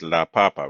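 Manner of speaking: "papa" stressed on the first pa; teacher showing "la papa" with a light final syllable. Shown as a demonstration. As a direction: say "papa" as PA-pa, unaccented ending. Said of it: "Notice no accents".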